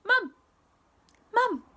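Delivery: put-on high, loud baby squeal; very high, punchy little baby voice; baby's first word. A narrator's reading. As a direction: blurt it like a proud toddler. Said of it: "she said".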